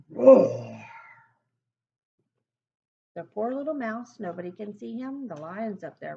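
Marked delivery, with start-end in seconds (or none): 0.1-0.9 big low lion roar; loud lion roar. Acted. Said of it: "how big he's roaring".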